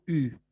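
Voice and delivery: "U" as a rounded French u, between "oo" and "ee"; upsilon alone. Shown as a demonstration. Teacher showing the lone upsilon, "U" as rounded ü. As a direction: say "U" with lips rounded on an "ee". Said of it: "Cuando está solita".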